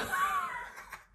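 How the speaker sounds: high, breathless burst of real laughter; giggling helplessly mid-story; laughing fit. Real, not acted. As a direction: laugh so hard you can't get words out. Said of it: "I can't even talk".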